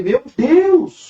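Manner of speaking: high, loud exclamation of amazement; astonished outcry. Retold or imitated. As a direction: cry out, overwhelmed by the miracle. Retells his astonished outcry at miracle. (exclamação de espanto)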